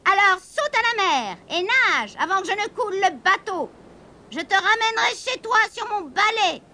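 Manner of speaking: high, swooping witch voice. A narrator's reading. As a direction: order the kitten about as a witch.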